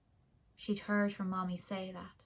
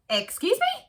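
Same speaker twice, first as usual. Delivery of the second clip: much higher, polite flamingo squeak; much higher, politely questioning flamingo; 13 semitones higher, 6 dB louder than usual. Acted. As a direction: pipe up like a small, polite bird. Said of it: "said the first flamingo".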